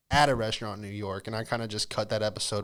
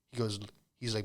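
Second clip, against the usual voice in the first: normal talking voice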